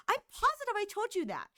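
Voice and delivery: lightly voiced insistent friend; imitating friend. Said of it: "She's like".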